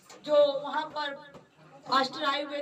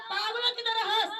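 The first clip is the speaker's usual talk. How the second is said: urging laugh; 7 semitones higher than usual. Retold or imitated. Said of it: "कहता".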